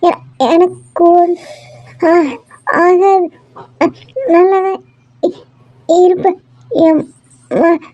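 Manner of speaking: halting, broken, each word a struggle; barely holding on, stammering out each word; faltering dying words. Acted. Dying wife to her weeping husband, halting and broken.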